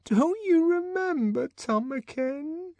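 high, slow, faltering plea; trembling. Acted. Linda repeating her plea to the director, trembling. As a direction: let the voice shake with hurt. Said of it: "in a voice that trembled".

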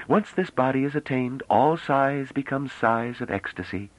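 high, level, solemn pronouncement; solemn, drawn out at the end. A narrator's reading. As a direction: intone it gravely in a high female character voice. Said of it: "said the woman solemnly".

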